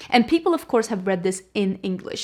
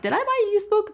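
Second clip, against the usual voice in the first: mock disbelief; 12 semitones higher, much faster than usual. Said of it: "I'm like".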